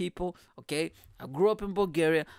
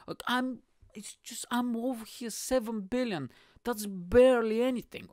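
higher-pitched, hammed-up complaint; mock poor-me pleading; 6 semitones higher than usual. Billionaire claims seven billion is nothing, mock self-pity. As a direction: complain as if truly hard up.

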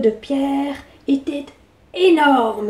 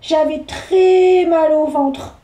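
slow, drawn-out justification; a boy squirming, stretched slow; squirming excuse; 3 semitones higher, slower than usual. Acted. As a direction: wriggle out of it. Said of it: "se justifia Pierre en se tortillant".

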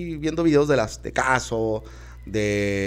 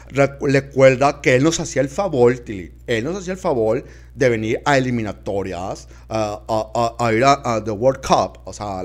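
usual speaking voice

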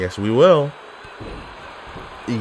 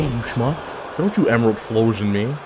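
ordinary talk, nothing marked

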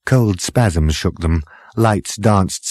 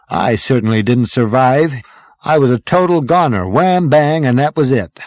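louder, higher-pitched lively storyteller; breezy braggart; 5 semitones higher, 5 dB louder than usual. A narrator's reading. Zaphod tells how he didn't survive, breezy. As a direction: recount it with punchy swagger. Zaphod recounts his death.

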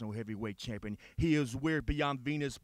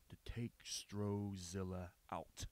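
slow whisper; 6 semitones lower, 13 dB quieter, slower than usual. Acted.

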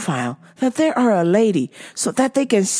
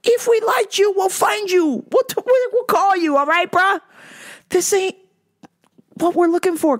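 much higher, emphatic telling-off; heated scolding; 8 semitones higher than usual. Real, not acted. Warning fake profiles off, high heated scolding. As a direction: lay down the law.

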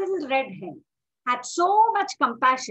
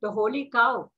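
usual speaking voice

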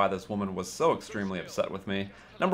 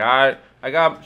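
put-on loud drunk woman; 2 semitones higher, 9 dB louder, slower than usual. Drunk woman waving money at door.

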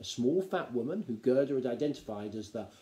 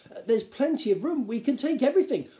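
eager, higher-pitched pleading; keen but embarrassed, louder; flustered young gnome; 12 semitones higher, 6 dB louder than usual. A narrator's reading. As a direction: stumble into an earnest pitch.

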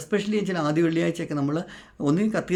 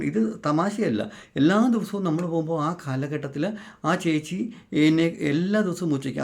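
ordinary talk

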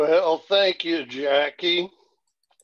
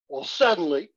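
plain reading voice

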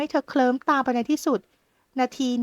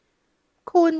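sharp, loud, high outcry; a sudden loud protest; startled cry; 5 semitones higher, 6 dB louder, much slower than usual. Acted. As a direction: cry out in shock at his touch. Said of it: "หมอนทองโวยวาย".

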